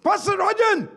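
calls the name loud and high across a car park; shouting out to get the pastor's attention; calling out. Retold or imitated. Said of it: "somebody shouted".

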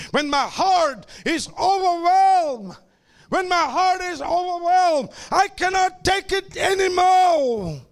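shouted, slowed cry of despair; preaching at full force. Acted. Preacher voicing David's overwhelmed heart, shouted.

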